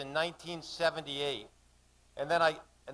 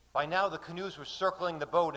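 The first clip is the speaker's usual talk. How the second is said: ordinary delivery, nothing marked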